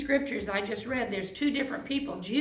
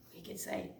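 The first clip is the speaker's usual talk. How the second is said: barely whispered, almost no voice; hushed whisper; 13 dB quieter than usual. Acted. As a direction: lean in and breathe the words. Jesus speaking tenderly to us.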